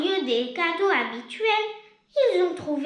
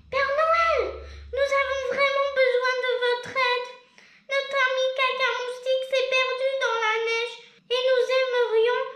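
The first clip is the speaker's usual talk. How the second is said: put-on high, sing-song voice of children asking a favour; pleading children; 8 semitones higher than usual. Friends asking Father Christmas for his reindeer, a sing-song plea.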